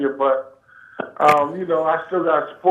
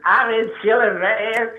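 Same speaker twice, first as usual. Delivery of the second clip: lightly pitched-up mimicry of a friend; mimicked cartoonish voice; 4 semitones higher, slower than usual. Caller teasing his friend's reply, mock cartoonish imitation. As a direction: playfully echo his cartoonish way of talking. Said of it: "you still sound like a cartoon".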